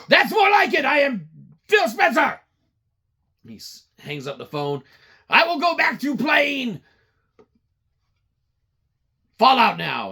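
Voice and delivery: high, loud, self-satisfied boss; the boss gloats at his staff before hanging up, high and loud; smug boss. Retold or imitated. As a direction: gloat like a spoiled tycoon. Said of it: "boss replies".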